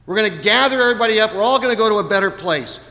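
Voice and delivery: high, quick, eager comforting; hurried, over-sweet reassurance; wished-for reassurance. Pretend. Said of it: "We want Jesus to say".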